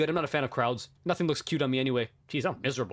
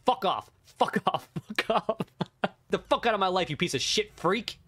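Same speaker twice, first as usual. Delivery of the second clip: mock angry yell; 6 semitones higher, slower than usual. Mock telling the writer off.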